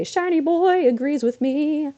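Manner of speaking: high, slow, drawn-out cooing; stretched-out high, playful voice; playful sing-song aside. Real, not acted. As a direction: break off into a silly high voice. Playful aside about Shiny boy.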